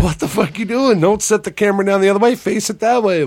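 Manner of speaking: high, loud, rapid fussing; retold nervous giddiness, bossing someone about; imitated giddy panic. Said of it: "I get giddy".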